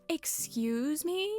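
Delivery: slow, high, drawn-out excuse me; affronted. Acted. Offended by the villager's greeting, a slow high sassy drawl.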